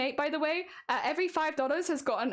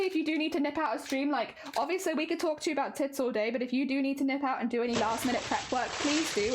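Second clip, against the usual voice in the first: ordinary talk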